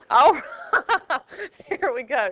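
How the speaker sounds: laughing. Real, not acted. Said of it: "That's what he said".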